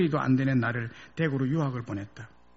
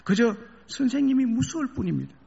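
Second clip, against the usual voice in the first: high, pleading student; a student begging through tears; tearful plea; 8 semitones higher than usual. A narrator's reading. Student to professor, a tearful high plea. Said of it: "울면서".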